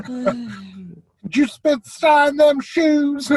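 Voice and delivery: put-on very slow, high Southern-accented dwarf. Dwarf asking about shoes in a slow, high Southern accent.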